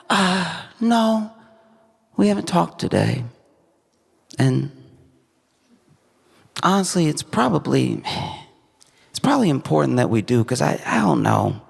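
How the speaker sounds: high-pitched, halting acted confession; hesitant confiding daughter. Daughter confides marriage doubts to mom, hesitant high voice.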